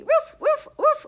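high, short woofs; dog barks. Pretend. Reader makes animal sounds with the children, high barking. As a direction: yap like a little dog. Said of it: "make some animal sounds with me".

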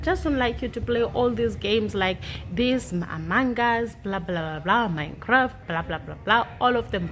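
normal talking voice

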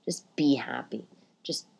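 soft hushed whisper; a quiet whispered reminder. Real, not acted. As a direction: breathe it as gentle advice.

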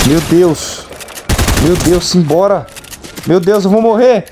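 frantic under fire, begging the gun to load; being chased. Real, not acted. Under fire, gun won't reload.

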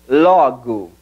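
LOGO as "LAW-goo"; open o, reduced o. Shown as a demonstration. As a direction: open the first o in LOGO, shrink the last to u. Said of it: "Abre la primera O y reduce la segunda".

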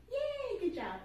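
high, loud happy cheer; excited cheer. Real, not acted. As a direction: celebrate finishing the challenge. Cheering at end of challenge.

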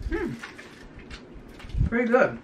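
talking between bites; chewing. Real, not acted. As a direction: mouth partly full. Seen 0: Bit chocolate at -4, jaw chewing, piece in hand during words.

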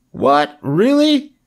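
very high piglet squeak; astonished cry. A narrator's reading. Wilbur hears he shall not die, astonished cry. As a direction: leap up high in hopeful disbelief. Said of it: "cried Wilbur".

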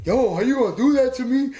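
a hint of an aggrieved old friend; mock hurt caller; indignant Cope on the phone. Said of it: "And Cope calls me".

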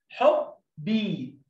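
حب، بي drawn out, very slow; slow blending. Shown as a demonstration. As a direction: pull حب and بي apart slowly. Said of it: "الحاء مع الباء حب".

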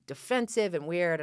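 ordinary talk, nothing marked

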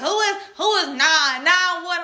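high, loud, baffled questions; confused dad. Retold or imitated. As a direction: imitate a bewildered father on the phone. Confused father questions crying daughter.

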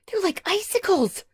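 very high startled exclamation; exclaiming. A narrator's reading. Aunt Patsy feels his cold paws, exclaiming. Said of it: "she exclaimed".

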